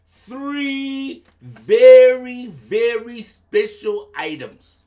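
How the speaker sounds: very drawn out and loud; stress on very. Acted. Sky King demanding special items, stretched and loud. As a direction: lean hard on the first very.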